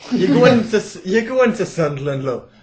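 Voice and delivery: high, loud, laughing DJ; barely holding it together; exasperated radio DJ. Retold or imitated. DJ reminding the caller of Sunderland, laughing. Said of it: "Like, uh".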